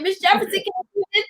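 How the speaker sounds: put-on high, fast kid's plea; imitating needy kids. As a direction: rattle off the kids' nagging like a child. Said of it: "the kids like".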